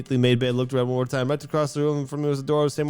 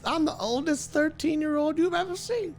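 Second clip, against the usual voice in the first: put-on old lady voice.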